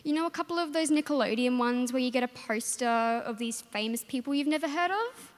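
ordinary talk

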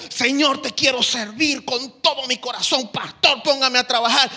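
loud, high, hammed-up eager church volunteer. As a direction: play the overzealous volunteer begging the pastor for work.

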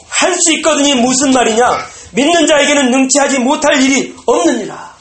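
high, loud stern rebuke; commanding Jesus; thundering rebuke. Acted. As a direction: rebuke the doubter loudly. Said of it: "호통 찢었을 수도 있는데".

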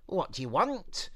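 put-on a plucky girl talking herself out of fear; Eliza. Eliza voiced.

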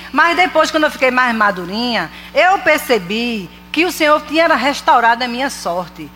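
ordinary delivery

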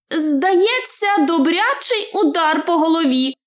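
high-pitched hobbit voice; Bilbo, admitting. A narrator's reading. Hobbit tells rescuer about his head, high admission. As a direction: own up in a high little voice. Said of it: "признався Більбо".

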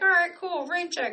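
high, bright-voiced friend saying rain check; mock friend's reply. Friend cheerfully cancelling plans, voiced high. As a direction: lightly act the friend agreeing.

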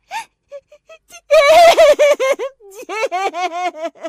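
high, trembling calls, loud at the end; shaky shout. Acted. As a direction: call him again and again, voice wobbling. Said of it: "با صدایی لرزان داد زدم". (فریاد لرزان)

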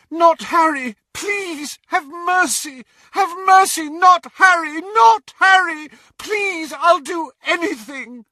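high, screamed pleas tumbling out; desperate pleading. Acted. Mother begging the killer for mercy on her son, hysterical. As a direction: beg hysterically at full voice.

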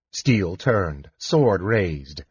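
ordinary delivery

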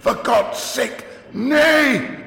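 high, slow, loud cry; shouting curate. Acted. Curate defying the narrator, shouting high and loud. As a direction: shout it at the top of his voice. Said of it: "shouted the curate at the top of his voice".

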